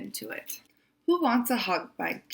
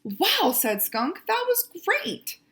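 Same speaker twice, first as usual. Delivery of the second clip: delighted surprise; 10 semitones higher than usual. Acted. Skunk thrilled by the hug, high and excited. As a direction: gush with delight.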